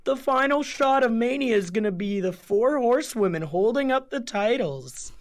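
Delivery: high, excited fan parody; mocking fan voice. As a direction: gush like a smug fan. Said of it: "smarks who were like".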